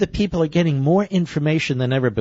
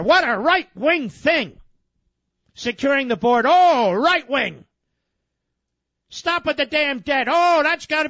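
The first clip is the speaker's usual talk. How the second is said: sarcastic mock outrage; 7 semitones higher than usual.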